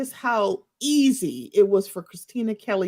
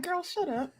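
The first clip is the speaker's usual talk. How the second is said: laughing dismissal, much softer and higher; 8 semitones higher, 9 dB quieter than usual. Retold or imitated. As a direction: giggle through a mock shut up. Mocking a cast member.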